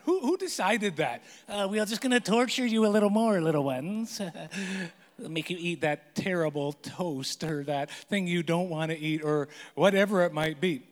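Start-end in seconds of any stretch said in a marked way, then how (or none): 1.5-9.2 "we are just gonna torture you" rushed, in a hammed-up teasing parent voice; mock mean parents. Pastor mocking parents who make kids eat breakfast first, teasing parent voice. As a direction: play a gleefully cruel parent, a bit fast.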